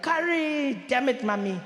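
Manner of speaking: mild mimicked outburst, high at the start; toned-down impression of his outrage; mock shock. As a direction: imitate his shocked cry without screaming. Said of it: "you're shocked and you're like".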